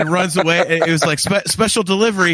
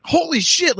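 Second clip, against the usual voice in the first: surprised exclamation; 10 semitones higher than usual. Retold or imitated. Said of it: "You know, like".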